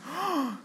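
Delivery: high, sharp mock gasp of a whole class; shocked class gasp. Class reacting to the teacher's comeback with a mock gasp. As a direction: gasp as if the class can't believe it.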